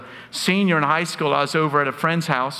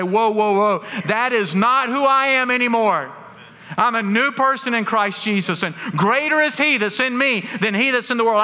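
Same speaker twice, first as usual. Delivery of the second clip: firm self-rebuke; 6 semitones higher than usual. Pretend. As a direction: shout down the old self with conviction. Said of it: "you can stop yourself and say".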